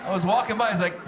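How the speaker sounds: normal talking voice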